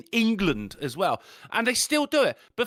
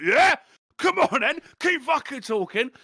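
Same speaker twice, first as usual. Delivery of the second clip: loud, higher taunt, squaring up to the bloke; mock fight taunt; 6 semitones higher, 4 dB louder than usual. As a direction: goad him like a mate spoiling for a fight.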